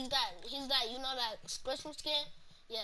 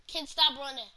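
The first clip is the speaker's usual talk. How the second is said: shouting; 3 semitones higher, 9 dB louder than usual. Real, not acted. Yelling at kid in game.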